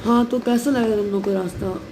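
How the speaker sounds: ordinary delivery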